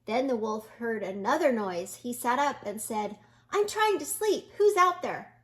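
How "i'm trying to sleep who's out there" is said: higher, louder irritated call; grumpy at being woken; annoyed call. A narrator's reading. Wolf calling into the bushes, annoyed and loud.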